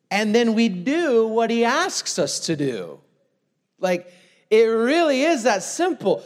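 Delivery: loud, high preaching push; fired-up preacher calling out his listeners; full-force preaching. Acted. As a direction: drive the point home to the congregation.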